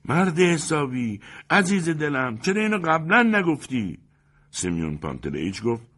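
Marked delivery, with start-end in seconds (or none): 0.0-4.0 high, loud, panicked appeal; anxious, high-pitched dismay; flustered and anxious. A narrator's reading. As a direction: plead in a fluster. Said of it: "با اضطراب و دستپاچگی گفت".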